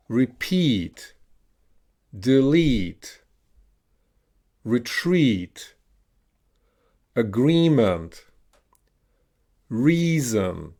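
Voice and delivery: each word said alone with a drawn-out EE, as in "delete"; "reason" with a long EE, falling tune; long E modelled. Shown as a demonstration. Teacher has learners repeat long E words, careful drill delivery. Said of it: "pronounce the E as in tea".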